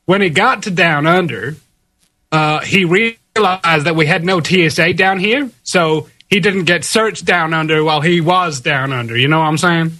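put-on American accent, laid-back. Shown as a demonstration. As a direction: keep up the American impression. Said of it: "an American accent".

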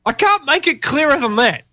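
usual speaking voice

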